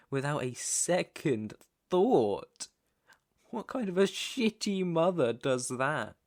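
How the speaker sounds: loud, high, drawn-out indignation; angry outburst. Acted. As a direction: hammer the words out slowly in outrage. Angry outburst about the abandoning mother.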